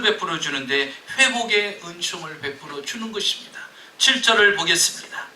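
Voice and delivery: loud, high fervent sermon; fervent preaching. Acted. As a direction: preach each loss with rising force. Preaching loss at full force.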